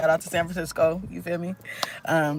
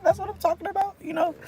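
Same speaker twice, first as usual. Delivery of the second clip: much higher, playful squeal of delight; playful teasing squeal; 3 dB quieter than usual. Real, not acted. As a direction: tease the viewers about their new love, squealing. Teasing chat about being booed up.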